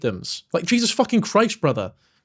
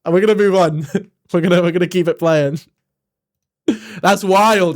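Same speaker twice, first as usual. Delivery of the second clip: excited outburst; 4 dB louder than usual. Real, not acted. Reacting loudly to clip, laughing.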